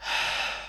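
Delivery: soft breathy sigh of reluctance; a wife sighing, unconvinced about a new dishwasher; weary sigh. Acted. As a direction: let out a quiet, resigned sigh.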